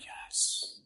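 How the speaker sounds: a breathless whispered yes. Retold or imitated. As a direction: whisper it like the men craving it. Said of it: "All the men here are like".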